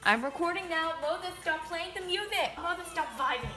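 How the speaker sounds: loud, high yell across the room. Real, not acted. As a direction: shout over the music. Yelling at sibling playing music.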